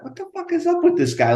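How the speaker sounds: mock bewildered inner voice; incredulous. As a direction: squeak it out in disbelief. Baffled inner reaction to the nervous super.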